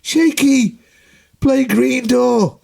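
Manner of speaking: high, loud, slow crowd yell; heckling shout. Retold or imitated. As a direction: shout a request at the stage.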